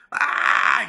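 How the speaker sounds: breathy gasp, a surprised yelp; 崖から落ちる瞬間の息をのむ声; mock gasp. Crashing off the cliff.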